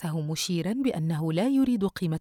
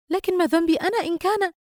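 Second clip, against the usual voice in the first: high, loud exasperated protest; upset protest; 12 semitones higher, 5 dB louder than usual. Acted. Daughter to scolding mother on phone, upset protest. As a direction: raise pitch and volume in frustrated self-defence.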